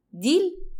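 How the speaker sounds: put-on high little-girl questioning voice. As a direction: ask it like a small child. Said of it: "وبص على البنوتة الصغيرة".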